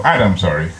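ordinary talk

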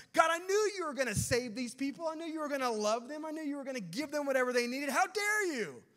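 high, loud, mock-outraged rant; Jonah. Jonah railing at God for saving Nineveh, mock outrage. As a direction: pile up accusations in a high, indignant yell.

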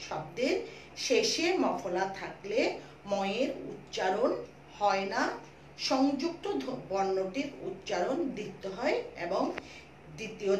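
slow, phrase by phrase with pauses; slow dictation. Shown as a demonstration. Teacher giving pupils rule three to note, slow dictation. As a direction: dictate the rule at a drawn-out pace.

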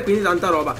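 ordinary talk

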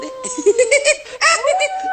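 high delighted giggle; gleeful giggle. Acted. Pumuckl giggles watching the kids slide.

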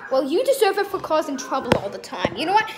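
ordinary delivery

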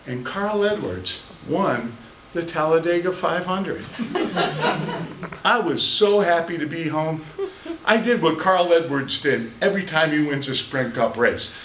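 ordinary talk, nothing marked

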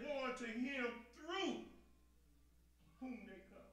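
«through» протянуто и выделено, медленно; stress on through. Shown as a demonstration. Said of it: "I had to underline that word".

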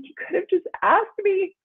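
put-on high child's voice, matter-of-fact; imitating her little son. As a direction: play the three-year-old stating the obvious. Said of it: "he was like".

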